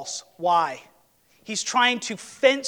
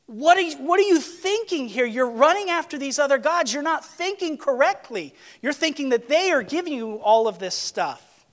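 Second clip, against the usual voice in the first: raised, higher pleading cry; passionate outcry; 7 semitones higher than usual. Acted. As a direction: cry out in disbelief at her. God voiced, passionately disturbed.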